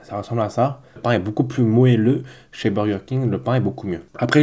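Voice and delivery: normal talking voice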